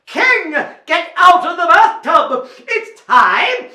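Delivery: loud, high command; knight calling out. Acted. Knight to king in the bathtub, loud call. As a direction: order the king out heartily.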